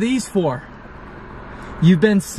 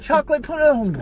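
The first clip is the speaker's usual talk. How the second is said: mock relief; 10 semitones higher than usual. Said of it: "be like".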